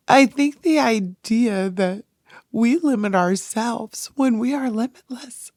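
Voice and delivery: fighting back tears while speaking; real emotion breaking through; choked up. Real, not acted. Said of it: "I don't know why I'm so emotional".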